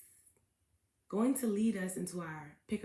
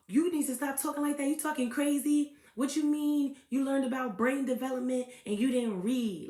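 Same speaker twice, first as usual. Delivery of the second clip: higher, louder mock rant; imitating angry interviewer; 5 semitones higher, 6 dB louder than usual. Host mimics angry interviewer scolding the guest. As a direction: act out his heated scolding. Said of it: "the interviewer was so mad".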